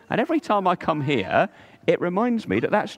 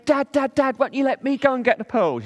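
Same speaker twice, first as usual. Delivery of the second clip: put-on high, eager child's begging voice; pleading little boy; 8 semitones higher than usual. Carlos begging his dad to dive, high child voice. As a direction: nag like an impatient small boy. Young son pleading with father.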